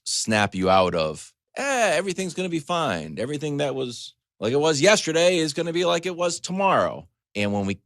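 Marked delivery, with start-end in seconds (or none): 1.6-7.1 high, breezy dismissive voice; mock carefree. Mocking his old self, breezy and careless. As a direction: toss it off like nothing matters.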